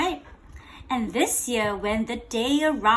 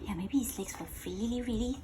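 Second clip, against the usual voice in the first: fast, hushed whisper; 10 dB quieter, faster than usual. Real, not acted. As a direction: lean in and whisper it. Whisper to child.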